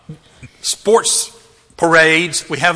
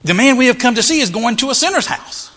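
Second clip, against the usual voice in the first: higher-pitched indignant crowd; grumbling complaint; 8 semitones higher, 3 dB louder than usual. Acted. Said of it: "they grumbled, they complained".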